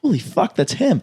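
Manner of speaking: high, starstruck recognition; retelling the moment he spots him; suddenly starstruck, pitch jumping up; excited. Retold or imitated. Spots the celebrity in VIP.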